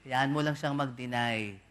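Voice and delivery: usual speaking voice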